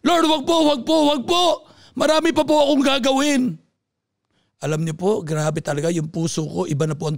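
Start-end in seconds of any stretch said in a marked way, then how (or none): 0.0-3.4 high, loud desperate plea, begging for more time; panicked cry to God. Retold or imitated. Man whose heart stopped begging God to spare him, screaming in panic. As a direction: scream out please no, not yet. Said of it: "napasigaw po ako".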